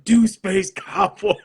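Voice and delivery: high, drawn-out mock yell with a laugh; retold yell. Fan recalling yelling at the band from beside the Porta-Johns, mock yell. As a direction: holler a request, hammed-up and laughing.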